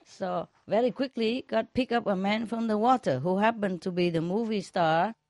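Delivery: ordinary talk, nothing marked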